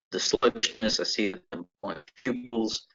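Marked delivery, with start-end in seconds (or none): none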